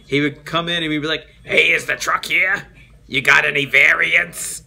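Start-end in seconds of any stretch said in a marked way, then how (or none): 1.4-4.6 loud, hammed-up Italian accent; thick Italian accent. Customer walking into Toys R Us asking, thick Italian accent. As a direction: belt it out as an Italian regular. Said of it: "thick Italian accent".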